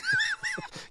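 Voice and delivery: high laugh. Real, not acted. Laughs at own story.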